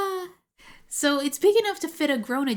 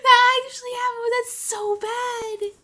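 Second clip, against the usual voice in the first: excited outrage; 11 semitones higher, 8 dB louder than usual. Real, not acted. Outraged laugh about Americans.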